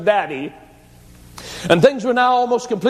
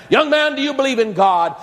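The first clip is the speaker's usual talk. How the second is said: preacher's raised shouting voice; shouted question; 8 semitones higher than usual. A narrator's reading. Preacher shouting at the drunk young man, raised voice. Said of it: "fairly shouted in the face".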